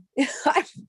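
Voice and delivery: a quick high laugh breaking into the story; short laugh. Real, not acted. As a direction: laugh lightly at the absurdity. Laughing mid retold quote.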